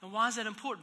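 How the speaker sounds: usual speaking voice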